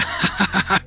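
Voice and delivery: high cackle; cackling laugh. Real, not acted. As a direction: laugh it off wickedly. Laugh after joke.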